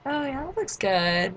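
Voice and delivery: high, slow flirty coo; flirting. Retold or imitated. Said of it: "flirting with them while we were ordering".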